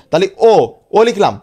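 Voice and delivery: ordinary talk, nothing marked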